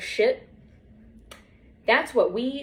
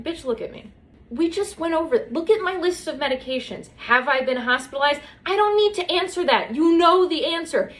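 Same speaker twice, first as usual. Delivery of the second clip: high, exasperated sass; mock exasperation at the doctor's question; sassy; 7 semitones higher than usual. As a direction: talk down to the doctor, pitch up. Retold sassy answer to doctor.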